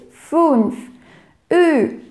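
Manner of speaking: fünf with the Ü as an I with rounded lips; teaching pronunciation. Shown as a demonstration. Teacher demonstrates the rounded Ü sound carefully. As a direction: say Ü with pursed lips, like I. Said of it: "você pronuncia o I com biquinho".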